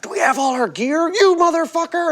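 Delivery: high-pitched put-on scolding; fake angry yelling. Bandmate fakes yelling at me while winking, put-on anger. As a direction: pretend to chew out a bandmate. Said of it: "yelling at me and winking".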